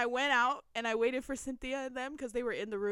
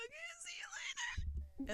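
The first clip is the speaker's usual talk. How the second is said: tiny high whispered squeak; starstruck whisper; 12 dB quieter than usual. Retold or imitated. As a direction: reenact losing your voice in front of an idol. Retold starstruck breathless reply.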